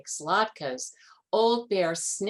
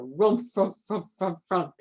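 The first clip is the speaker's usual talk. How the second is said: bear growls; 4 dB louder than usual. A narrator's reading. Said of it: "he growled".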